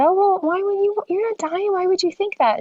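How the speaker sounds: high comforting mother; reassuring mom voice. Retold or imitated. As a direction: gently talk her out of the fear. Mother comforting crying daughter.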